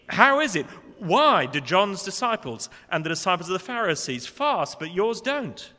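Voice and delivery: loud, high challenging question; accusatory. Retold or imitated. Critics challenging Jesus about fasting, loud accusatory question. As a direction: demand an answer, indignant. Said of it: "Not genuine, but accusatory".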